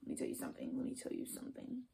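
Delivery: 息だけの囁き声で; 秘密を打ち明けるように囁く; whisper. Real, not acted. Warning the corny ones.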